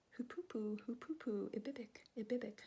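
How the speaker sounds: breathy whispered bird call. Acted. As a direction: coo it like a hoopoe bird. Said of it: "that's all he knows how to say".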